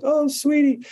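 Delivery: high, tender coo; sweet, loving parent. Retold or imitated. Parent to daughters when they get hurt, sweet cooing.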